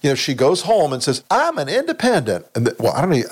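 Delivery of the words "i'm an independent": higher, lightly put-on mock senator voice; a hammed-up, badly faked impression of her; mocking a senator's claim in a higher put-on voice; bad Sue Collins accent. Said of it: "that's a bad Sue Collins accent".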